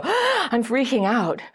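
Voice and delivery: hammed-up sudden freak-out; acted panic. Dater whose attachment wound suddenly shows up, acted panic. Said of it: "you suddenly go".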